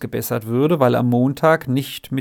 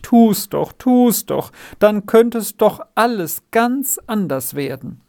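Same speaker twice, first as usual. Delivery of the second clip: high, urgent, repeated plea; imploring; 7 semitones higher than usual. A narrator's reading. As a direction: beg hard, pitch well raised. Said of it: "bat das Margretli flehentlich".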